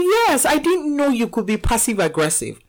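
retelling the son's line with amused emphasis; mock son. Mother retelling her son's jab, amused emphasis. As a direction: replay his words a bit brighter, half laughing. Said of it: "He was like".

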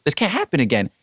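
understated mad protest; retold anger. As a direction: insist in retold outrage. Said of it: "I was so mad".

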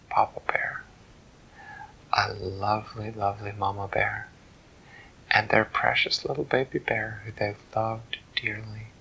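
plain reading voice

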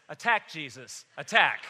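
mock parrot command, building to a loud shout; parrot shouting attack. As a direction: bark the order like a parrot siccing the dog. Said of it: "And the parrot says".

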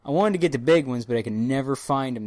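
ordinary delivery

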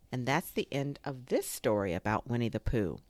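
ordinary delivery

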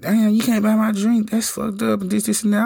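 high mock girly voice; mimicking the woman. Voicing the woman at the bar.

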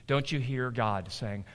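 ordinary delivery

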